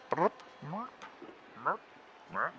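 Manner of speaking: high, soft little chirping merps; a high squeaky cat impression; Marvin noises. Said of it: "try and do an impression of Marvin".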